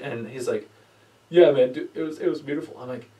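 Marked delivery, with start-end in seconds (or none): 1.3-2.8 teary friend. Retold or imitated. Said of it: "Are you crying?".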